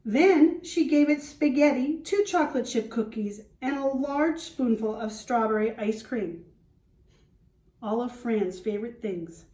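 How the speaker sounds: put-on lively read-aloud for young children; storybook reading. Teacher reading to the class, storybook voice. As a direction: read it like a picture book to the class.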